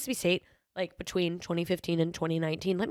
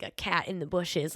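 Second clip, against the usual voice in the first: ordinary delivery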